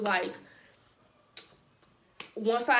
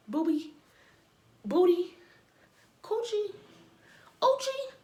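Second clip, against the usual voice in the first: rising mock list; 8 semitones higher, slower than usual. Mocking his grabbing of a girl.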